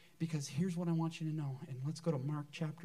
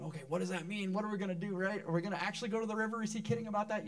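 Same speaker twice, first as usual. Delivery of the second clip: higher and louder, rushing the river question; mock doubtful churchgoer; 5 semitones higher, 5 dB louder, faster than usual. Pastor imitates doubtful members, higher, louder and rushed. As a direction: play a startled skeptic for a laugh. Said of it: "some people thinking".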